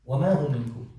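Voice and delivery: "وَمَا هُمْ مِنْكُمْ" said evenly, unpunched; understated, factual "وَمَا هُمْ مِنْكُمْ"; no emphasis. Acted. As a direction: state "وَمَا هُمْ مِنْكُمْ" as plain fact. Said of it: "There's no emphasis".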